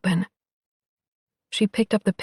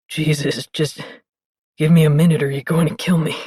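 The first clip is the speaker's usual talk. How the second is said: low, rushed, overwhelmed man; low and fast, barely holding on; deep male voice; 4 semitones lower than usual. Acted.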